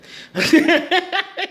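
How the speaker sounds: big high burst of laughter; loud high laugh. Real, not acted. Laughing loud at her own retort to the woman.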